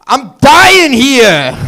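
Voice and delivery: dying New Yorker bellowing; brash New York accent shout. Retold or imitated. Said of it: "New Yorker on his deathbed".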